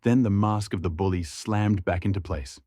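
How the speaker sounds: plain reading voice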